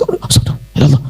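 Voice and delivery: hushed, shocked gasp to God; startled whisper. Retold or imitated. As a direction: breathe it out in shock. Startled wife at husband's loud voice.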